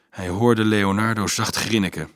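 usual speaking voice

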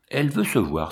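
plain reading voice

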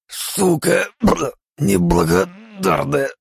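high gurgled curse, slow and strained; gurgling through pain. Acted. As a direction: spit it out while choking on slime. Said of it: "Пробулькал я сквозь боль". (булькающий сквозь боль голос)

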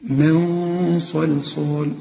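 من صلصال very slow, nun hidden and heavy before the emphatic sad; slow Quranic recitation; recited with heavy ghunna. Shown as a demonstration. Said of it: "الغنة هنا هتفخم علشان الصاد مفخمة".

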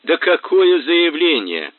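loud, slow mocking laugh through the words; guffawing. A narrator's reading. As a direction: scoff at her. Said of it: "заржал Кацуба". (ржёт, издеваясь)

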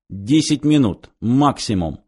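ordinary delivery, nothing marked

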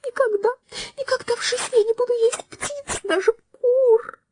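very high, tearful sobbing cry. A narrator's reading. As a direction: sob it out through tears. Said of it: "дама в зале просто разрыдалась".

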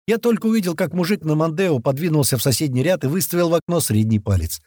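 usual speaking voice

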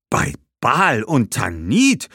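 langsam, hoch, Tanit steigend; Ausruf. Acted. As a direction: ruft überrascht die Götter an. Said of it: "rief Hannibal wieder". (Hannibal ruft überrascht „Bei Baal und Tanit“)

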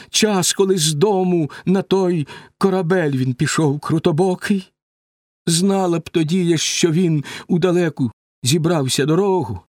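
high-pitched, anguished reproach; grieving mother's lament, voice lifted high; Penelope, tearful reproach. A narrator's reading. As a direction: reproach them in rising distress. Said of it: "мовить крізь сльози до них Пенелопа".

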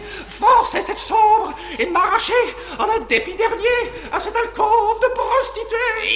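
bitter voice rising to a loud cry; bitter outburst. Acted. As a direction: let the resentment boil over. Dépit croissant, éclat amer. (éclat de dépit amer)

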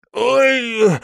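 high, sustained yelp; sharp high "ow" after a knock; cry of pain. A narrator's reading. Prince bangs his forehead in the dark, yelps in pain. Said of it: "Ухватился за лоб руками и закричал".

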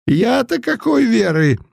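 put-on high mumbling old man voice; toothless mumble. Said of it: "продолжал шамкать Путилин".

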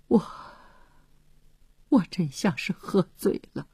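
soft, halting murmur; muttering to himself. A narrator's reading. Philip at the end of his nerves, muttering to himself. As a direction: murmur it with a pause after the first word. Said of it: "他喃喃自语着".

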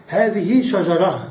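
"شجرة" stopped on a breathed h, "shajarah"; pausal h ending. Shown as a demonstration. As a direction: slowly end "شجرة" on h, no t. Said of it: "وتقف عليها بالهاء".

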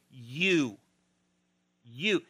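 "You" said alone, drawn out and pointed; emphatic. Acted. Jesus putting the task on the disciples, emphatic. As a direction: land on "You" as its own sentence. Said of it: "He said emphatically".

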